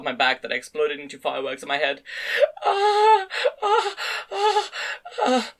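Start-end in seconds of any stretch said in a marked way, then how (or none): 2.6-5.5 very high moans, climbing; rising moans. A narrator's reading. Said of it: "Asana's moans becoming higher and higher".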